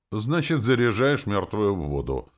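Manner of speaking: pompous, forceful statement; self-important booming. A narrator's reading. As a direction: boom it out importantly. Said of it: "важно прогудел Товарин".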